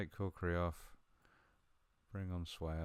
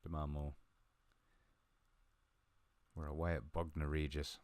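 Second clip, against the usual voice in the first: ordinary delivery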